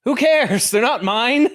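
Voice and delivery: high, loud, disbelieving; incredulous partner. Acted. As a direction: shriek it in disbelief. Continuing the callous voice.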